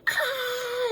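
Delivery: high piercing scream of fright; retold scream. Screaming at a creature flying toward her. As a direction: shriek at something flying at you. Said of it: "And I just start screaming".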